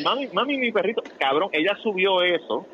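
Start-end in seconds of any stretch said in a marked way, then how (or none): 0.0-1.0 put-on higher whiny little-boy voice; mock crying child. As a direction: imitate a sad child calling his mom. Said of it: "y él".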